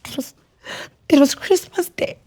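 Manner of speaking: high, tearful, halting voice; real crying. Real, not acted. Guest trying to tell of Christmas Day, in tears. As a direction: push through the tears, voice pitched high. Crying, crew then fetches tissue.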